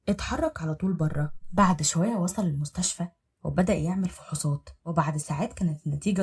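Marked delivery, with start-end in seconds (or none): none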